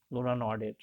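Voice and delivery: ordinary delivery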